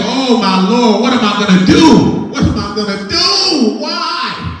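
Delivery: high, wailing mock-despair cries. Imitating a dumped person, high hammed-up despair. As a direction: ham up the heartbroken wail.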